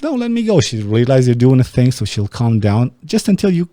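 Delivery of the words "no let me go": mock high-pitched girl voice, up high and light; high mock woman voice. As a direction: squeak it like a struggling girl.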